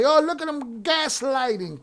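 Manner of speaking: high, drawn-out mock exclamation; mocking girls' call-out. Mimicking girls calling out a gaslighter, high mocking quote. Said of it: "that girls say".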